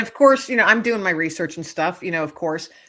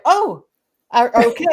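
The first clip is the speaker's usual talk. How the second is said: startled; 5 semitones higher, 9 dB louder than usual. Retold or imitated. Startled by bird's screech.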